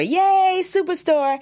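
high-pitched celebratory yay; squealed hooray for your own show; excited cheer. Real, not acted. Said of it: "The reason why I went yay".

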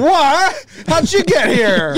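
high-pitched, incredulous yelp of "what"; mock shock. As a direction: play astonished at an unexpected visitor. Pretends shock at weed delivery person.

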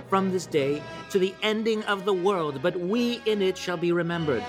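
stirring king's speech to his soldiers; amateur Shakespeare recital. Acted. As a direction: declaim it grandly, lifting key phrases high. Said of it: "presented to you by me in my".

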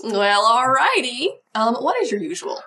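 easy Southern twang; Southern accent. Acted. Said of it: "I don't know why Judy has this accent".

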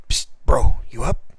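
breathy night-time whisper; whispering to a sleeping friend. Retold or imitated. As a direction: hiss it so you won't wake the house. Waking James at night.

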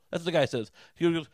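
ordinary delivery

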